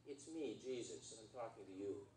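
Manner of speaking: low, soft, solemn heavenly voice; voice of God. Acted. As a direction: drop low and speak gently from above. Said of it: "the voice of God from heaven says".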